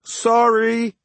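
high shout over the wind; shouted back. A narrator's reading. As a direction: yell the apology back. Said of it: "he shouted back".

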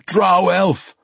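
high, urgent outcry; agitated dwarf exclamation; crying out. Acted. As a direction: cry it out in alarm. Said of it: "Bruner cried at him".

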